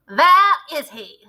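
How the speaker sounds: snapping. A narrator's reading. Witch snaps while searching the platform. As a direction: snap it out impatiently. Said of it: "snapped the Grand High Witch".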